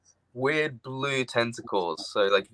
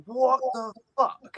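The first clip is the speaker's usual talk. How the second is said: stunned disbelief; 14 semitones higher, slower than usual. Retold or imitated.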